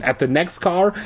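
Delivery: plain reading voice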